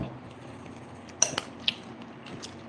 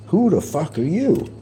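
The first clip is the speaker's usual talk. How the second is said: mock snarl, higher; retold demand; 9 semitones higher than usual. At the fan at the door, retold challenge. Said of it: "Those are my words".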